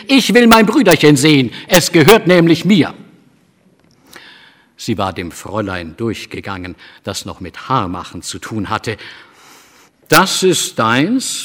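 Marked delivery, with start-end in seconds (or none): 0.1-2.9 put-on higher, loud, firm child; little girl demanding at the doctor's door, determined. A narrator's reading. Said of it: "sondern bloß sehr bestimmt". 10.1-11.4 slow, drawn-out surprised question; astonished. A narrator's reading. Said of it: "fragte das Mädchen erstaunt".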